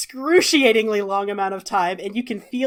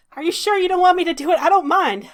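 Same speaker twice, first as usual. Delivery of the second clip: fast, higher, anxious offer; eager worry; 9 semitones higher, 4 dB louder, faster than usual. Acted. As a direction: rush it, worried and eager. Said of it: "Salvador says".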